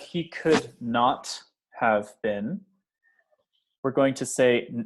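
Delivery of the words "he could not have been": "he could not have been" slowly, every word separate; slow full form. Shown as a demonstration. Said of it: "instead of".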